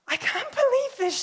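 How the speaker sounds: stunned disbelief. Retold or imitated. Seatmate hearing her hoped-for church, stunned disbelief. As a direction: blurt it out as if floored by a sign.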